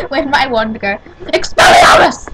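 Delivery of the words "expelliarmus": spell shout. Pretend. Casting a spell in play.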